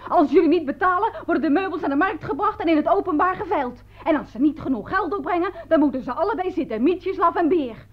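high, pompous mock threat; imitated police bully. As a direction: proclaim it like the officious fat officer. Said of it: "toen zei die dikke".

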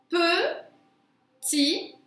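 "Petit" drawn apart, pe ... tii; high, separated syllables in "Petit", holding the final i. Shown as a demonstration. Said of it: "On l'entend à la rime".

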